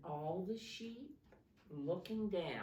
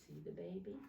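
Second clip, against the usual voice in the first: ordinary delivery, nothing marked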